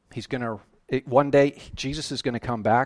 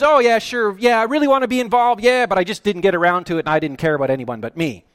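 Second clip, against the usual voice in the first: higher, louder, fast mock-kid excuses; mocking whiny kid; 7 semitones higher, 9 dB louder, faster than usual. Preacher mocking kids who dodge chores, fast and mocking. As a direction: sneer it like a lazy kid making excuses. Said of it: "because kids".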